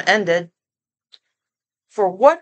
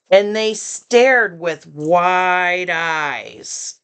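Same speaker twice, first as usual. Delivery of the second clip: usual speaking voice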